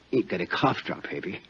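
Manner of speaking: ordinary talk, nothing marked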